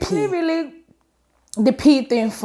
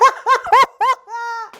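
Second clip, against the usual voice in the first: laughing; 12 semitones higher than usual. Real, not acted. Laughing after the plea.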